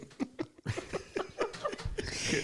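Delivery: soft, airy laughing fit; wheezing laughter. Real, not acted. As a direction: laugh until it is mostly breath. Laughs at the retold line.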